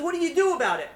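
high, loud indignant question; acted exasperation. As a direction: throw the question out as a scolding. Duke rebukes servant in story.

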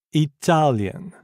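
Italian drawn out, TA syllable leaned on; slow stress drill. Shown as a demonstration. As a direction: stretch Italian slowly around its stress. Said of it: "faites attention aux syllabes accentuées".

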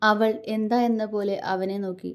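ordinary delivery

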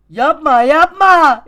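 very high, very loud scream of no; screaming don't in real panic; panicked scream. Real, not acted. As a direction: shriek in panic as you fall. Screaming as character falls.

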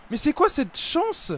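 high, loud cry of disbelief at a miss; a real gamer's outraged wail at bad luck; frustrated shout. Real, not acted. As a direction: yell it like the game just robbed you. Missed chance in FIFA match.

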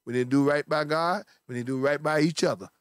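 ordinary talk, nothing marked

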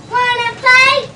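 loud, high, picking a fight; grouchy ladybug. Acted. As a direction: shout it out like a grouchy bug. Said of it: "said the grouchy ladybug".

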